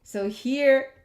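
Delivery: ordinary talk, nothing marked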